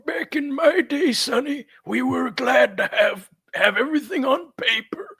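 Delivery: high, slow creaky grandpa voice; cranky old-timer reminiscing; very old man. Acted. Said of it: "All right, Grandpa".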